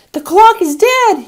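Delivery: put-on very high, loud, slow child's cry; a small boy horrified the clock has stopped; shrill-free but piercing child alarm, slow and loud; aghast child gasp. A narrator's reading. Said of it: "he gasped".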